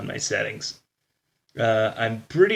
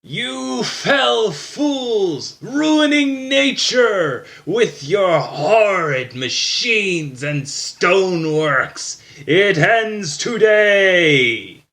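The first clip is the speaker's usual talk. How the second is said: slow, high, loud villain call; booming druid voice; 9 semitones higher, 8 dB louder, much slower than usual. Acted. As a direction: bellow it from the distance. Villain druid calls from afar.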